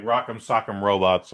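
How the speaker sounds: ordinary talk, nothing marked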